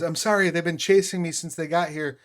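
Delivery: put-on jittery teenager rambling sorry; nervous teenage boy. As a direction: stammer out the apology, worried. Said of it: "the teenage boy says".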